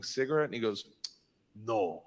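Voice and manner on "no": put-on low Russian accent. Cop voiced, low and close.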